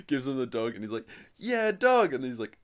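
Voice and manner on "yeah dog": high, loud, hammed-up cheer; mock excitement. Co-host imitating the boy getting a dog, excited shout. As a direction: shout it like a delighted kid imitation.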